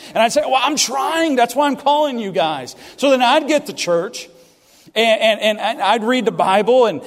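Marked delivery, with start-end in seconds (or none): 0.4-2.8 higher and louder, exasperated; frustrated protest. Retold or imitated. Preacher retelling snapping back at his parents, exasperated. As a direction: shout back in defence.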